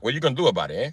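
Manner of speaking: lightly put-on Italian accent; understated mock-Italian challenge. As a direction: taunt with a touch of Italian-American. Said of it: "Why you talking all Italian".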